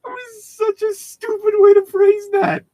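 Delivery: high, loud words pushed through real laughter; cracking up while mocking the sentence; laughing. Real, not acted. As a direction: laugh your way through the line at yourself. Laughing at his own phrasing.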